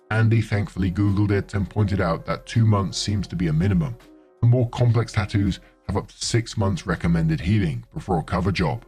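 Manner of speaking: usual speaking voice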